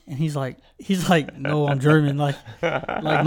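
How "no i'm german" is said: usual speaking voice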